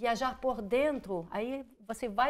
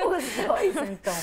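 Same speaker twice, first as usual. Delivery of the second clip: laughing; 3 semitones higher, 7 dB louder than usual. Real, not acted. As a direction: laugh it out playfully. Laughing, confirms both words.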